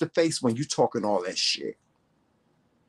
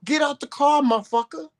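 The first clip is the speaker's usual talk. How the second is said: retold shouted dare; 11 semitones higher than usual. Daring the driver to get out, high shouted taunt. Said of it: "I said".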